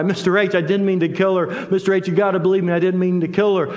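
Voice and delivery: high, fast, pleading retelling of tears; imitating crying plea. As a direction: echo his desperate plea, a touch of tears. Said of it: "And he began to cry".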